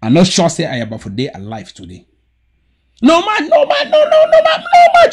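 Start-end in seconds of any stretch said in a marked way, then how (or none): 3.0-5.1 high, loud laughing cry of no man; laughing protest, much higher and louder; excited protest. Real, not acted. Laughing at the host, cutting in loudly. Interrupting another speaker, protesting.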